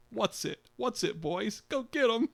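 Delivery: high, eager urging to the dogs; excited. A narrator's reading. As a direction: egg the hounds on, pitch climbing. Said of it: "he said excitedly".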